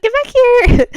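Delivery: very high, loud call after a runaway dog; chasing the dog, a high, loud panicked yell; shouting after the dog. Retold or imitated. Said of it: "I was like".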